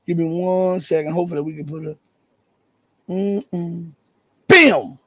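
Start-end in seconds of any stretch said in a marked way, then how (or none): 4.5-5.0 loud, punchy triumphant shout; triumphant exclamation. Real, not acted. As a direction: nail it like a winning reveal. Finds the article triumphantly.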